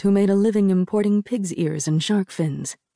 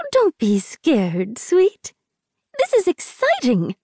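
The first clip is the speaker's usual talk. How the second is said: sugary coaxing; 7 semitones higher, slower than usual. A narrator's reading. Saccharine matchmaker coaxing Rin.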